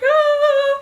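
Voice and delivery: high, loud delighted "ah"; fangirl squeal. Acted. Fan reacting to his grin, squealing. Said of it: "And I'm like".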